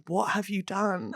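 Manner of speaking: slow, very soft and low question; restrained, near-silent reproach; hushed dread, imitated. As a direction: imitate a colleague quietly holding it together. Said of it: "consummate professional, but being like".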